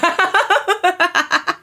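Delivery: real laugh, high; helpless high giggle. Real, not acted. Laughing at paper joke.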